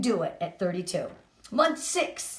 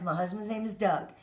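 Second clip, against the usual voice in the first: normal talking voice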